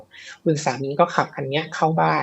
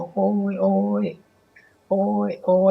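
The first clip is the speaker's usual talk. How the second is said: imitating a man groaning in pain, pitched up; mock pained groans; 4 semitones higher than usual. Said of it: "ร้องแบบร้องเจ็บปวด". (ครางโอดโอยด้วยความเจ็บปวดแบบเลียนเสียง)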